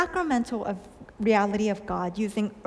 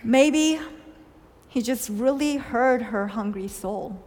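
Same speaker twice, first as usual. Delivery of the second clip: normal talking voice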